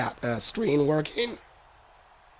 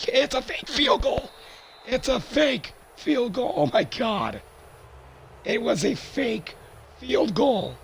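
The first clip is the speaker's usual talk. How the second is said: excited shout; 11 semitones higher than usual. Real, not acted. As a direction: yell it out in disbelief. Surprise fake field goal call.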